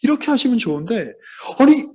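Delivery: ordinary delivery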